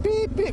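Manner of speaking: high electronic beeping sound; imitating a beep. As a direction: beep it out like a little device alarm. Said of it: "then it just went beep".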